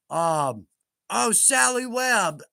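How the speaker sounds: ordinary talk, nothing marked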